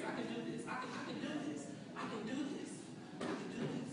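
very soft, private self-encouragement; hushed pep talk. Acted. Job seeker steadying herself before asking, hushed pep talk. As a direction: repeat it under your breath to build courage.

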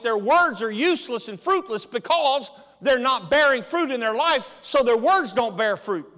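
loud, emphatic sermon delivery; preaching at full force. Acted. Pastor to congregation, loud preaching. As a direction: preach it out, hammering each line.

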